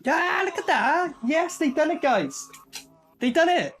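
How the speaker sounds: real burst of delight at the screen; excited cheer. Real, not acted. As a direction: cheer them on like a fan who's delighted. Cheering as stunt succeeds.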